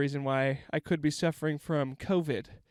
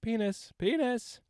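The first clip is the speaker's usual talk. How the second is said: calling a dog; 7 semitones higher, slower than usual. Retold or imitated. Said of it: "we're like".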